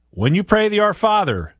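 ordinary talk, nothing marked

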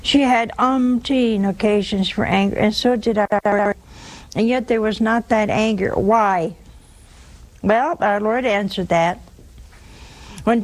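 usual speaking voice

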